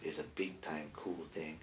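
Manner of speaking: unvoiced whisper. Real, not acted. Aside about coconut tree trimming, whispered. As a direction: confide it in a whisper.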